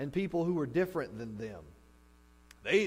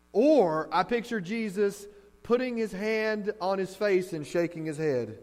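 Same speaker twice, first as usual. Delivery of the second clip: ordinary talk, nothing marked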